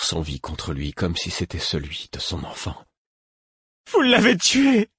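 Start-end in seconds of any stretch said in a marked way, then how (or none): 3.9-4.9 very high horrified cry; horrified accusation. A narrator's reading. Prince accuses the narrator over the body, horrified cry. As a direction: hurl the accusation in horror. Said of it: "me lança-t-il d'un ton horrifié".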